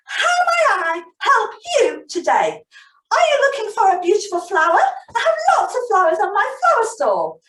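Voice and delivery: high, loud, quick shopkeeper chatter; bubbly high flower-girl patter; Flora Flower voice. Acted. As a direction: sell the flowers brightly like Flora. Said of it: "she said to him".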